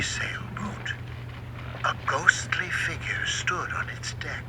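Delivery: hushed, eerie whisper; spooky hush. A narrator's reading. Narrator telling of the ghost boat, eerie whisper.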